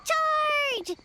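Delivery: high, rallying charge cry; battle cry. Acted. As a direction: yell it as you rush forward.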